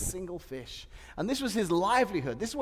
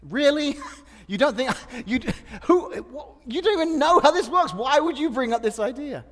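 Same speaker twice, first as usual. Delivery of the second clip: higher, louder, exasperated and laughing; mock indignant rant; 7 semitones higher, 8 dB louder than usual. Preacher playing a fisherman annoyed by advice, indignant. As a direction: huff at the meddling outsider. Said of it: "someone goes".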